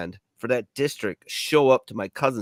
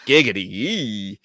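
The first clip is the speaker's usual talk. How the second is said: lewd catchphrase; slower than usual. Retold or imitated. Lewd catchphrase after cigarette bit.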